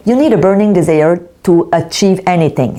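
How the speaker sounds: English quotation in a French accent; careful English, audibly French-accented. Real, not acted. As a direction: deliver the English line with a Québécois French accent. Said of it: "vous voyez mon super accent anglais".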